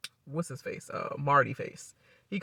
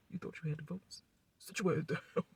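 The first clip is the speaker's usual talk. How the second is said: much softer mock taunt; hushed taunt; 11 dB quieter than usual. Mom taunting Cal in the camera, softly. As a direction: murmur the taunt smugly.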